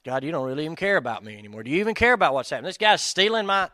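loud, rushed, rising protest; acting out worked-up indignation, fast and high; upset complaint to God. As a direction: fire off hurt questions at God. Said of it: "your emotions are like".

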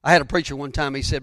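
ordinary talk

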